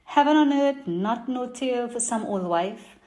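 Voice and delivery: put-on lively Trini market vendor accent; Trinidadian accent. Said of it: "I'm gonna do it in my best Trini market accent".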